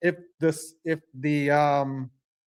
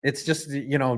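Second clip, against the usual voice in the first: ordinary delivery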